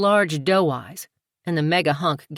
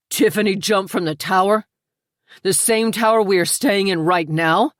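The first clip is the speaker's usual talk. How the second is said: louder, higher alarmed question; 4 semitones higher, 6 dB louder than usual. A narrator's reading. Jake hearing of the tower fall, alarmed and loud. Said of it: "Jake stood in alarm".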